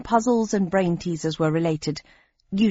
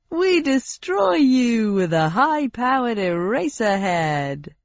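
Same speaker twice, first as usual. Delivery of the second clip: slow, cheerful menace from a host; game show host voice; 3 semitones higher, slower than usual. Acted. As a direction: announce doom like a prize. Host states the penalty.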